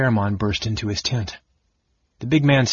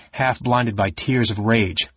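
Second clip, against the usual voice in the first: ordinary delivery, nothing marked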